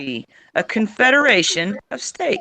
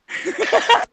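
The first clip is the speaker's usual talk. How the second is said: high, voiceless laughing; real wheezy laugh; breathless laughter. Real, not acted. Cracking up at friends' teasing, breathless laughter. Laughing over hairballs and accents.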